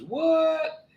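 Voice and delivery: very high, squeaky What?; hammed-up surprise from the back; startled what. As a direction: shriek What? in mock shock. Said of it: "he comes in and he goes".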